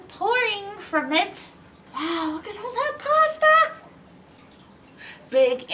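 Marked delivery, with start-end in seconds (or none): 1.9-3.7 amazed. Real, not acted. Reader's amazed aside.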